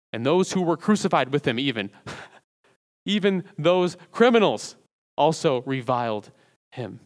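normal talking voice

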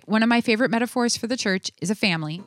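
ordinary talk

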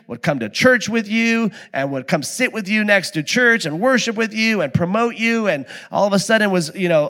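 ordinary delivery

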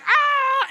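very high, loud imitated scream; toddler scream. Dad imitates daughter's scream before she switches. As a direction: shriek like a scared toddler. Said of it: "she goes from, like".

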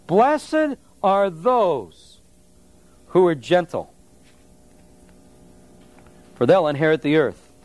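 very slow, drawn-out reading. Shown as a demonstration. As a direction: read it slowly, weighing each word. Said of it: "The idea is".

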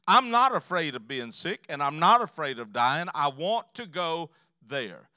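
loud, defiant testimony of faith; forceful, fearless declaration; emphatic declaration. Acted. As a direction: proclaim it firmly, slowing at the end. Mother declares her faith.

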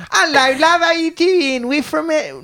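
high Essex twang between London and Australian; Essex accent. Shown as a demonstration. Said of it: "It's just somewhere in between".

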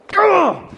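sudden loud, high "Gah!" imitation; John Piper. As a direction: explode into an exaggerated high yell. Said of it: "It's just John Piper going".

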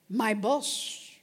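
ordinary talk, nothing marked

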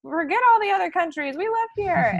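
high-pitched mock rapture; gushing expats. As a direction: imitate gushing enthusiasts, high and loud. Mimicking enthusiastic expats who love the place.

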